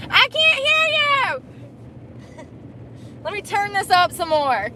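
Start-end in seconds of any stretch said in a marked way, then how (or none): 0.1-2.4 playful high call over blaring music; mock shout over music. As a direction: pretend you can't hear over the radio. Pretends music drowns her out.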